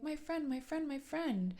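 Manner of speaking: lilting high call of a friendly being; gentle being. Retold or imitated. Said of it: "they're like".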